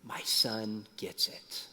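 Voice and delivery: slow, moved whisper; proud dad whispering to himself; emotional whisper. Retold or imitated. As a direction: breathe it out, overcome with pride. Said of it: "I'm getting emotional thinking about it".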